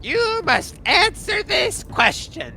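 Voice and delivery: high, slow, ceremonial riddle voice; kobold king's riddle. Acted. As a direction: intone the riddle like a mad little monarch. Kobold king poses a riddle.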